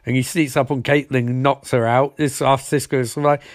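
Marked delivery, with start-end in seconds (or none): none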